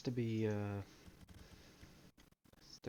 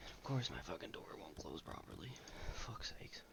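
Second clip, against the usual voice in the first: whispering; 6 semitones lower, 7 dB quieter than usual. Real, not acted. Back from a break, keeping quiet.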